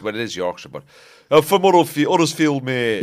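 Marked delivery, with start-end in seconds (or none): 1.3-3.0 put-on Huddersfield accent. Mocking the Huddersfield locals to friends, broad Huddersfield accent.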